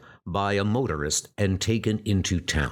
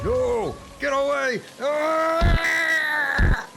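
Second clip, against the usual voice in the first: panicked yell ending in a scream; screaming get away; terrified shout; 4 dB louder, much slower than usual. Acted. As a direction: fend off the horse in terror. Man attacked by the horse.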